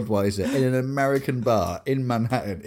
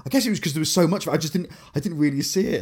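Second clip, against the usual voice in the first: flustered English apology; 6 semitones higher, 4 dB louder, faster than usual. Retold or imitated. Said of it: "I just turned so English".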